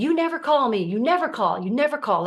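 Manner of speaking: loud, high, complaining mother; nagging mom. Retold or imitated. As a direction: whine it like a hurt mom guilt-tripping. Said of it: "Like my mom used to say to me".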